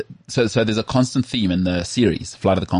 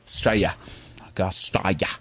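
mock Australian accent; 7 dB quieter than usual. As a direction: play at being a laid-back Australian. Mimics Australians saying their country's name.

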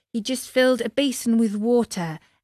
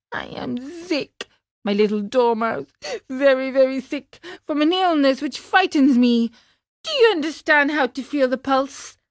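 higher-pitched, plaintive sick puppet; poorly little marionette voice; ailing puppet; 5 semitones higher than usual. A narrator's reading. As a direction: complain of a frightening illness. Frightened sick puppet to dormouse.

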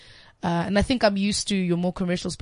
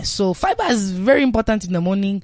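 Nigerian Pidgin; 2 semitones higher, 4 dB louder than usual. Pretend. Asked about breakfast, answering in Nigerian Pidgin. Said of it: "can you answer in, like, Pidgin".

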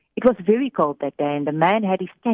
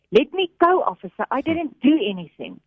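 protesting; 7 semitones higher than usual. A narrator's reading. Man protests being lifted.

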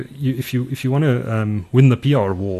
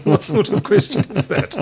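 laughing; 8 semitones higher than usual. Real, not acted. Laughing at absurd question.

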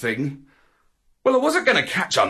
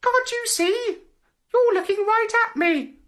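very high, tiny butterfly voice; fluttering little voice. Acted. Butterfly answers the searching dog, fluttering little voice. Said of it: "said a fluttering little voice".

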